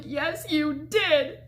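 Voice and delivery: slow, high mock-tearful insistence; near tears. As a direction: imitate arguing on the brink of tears, words drawn out. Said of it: "on the brink of tears".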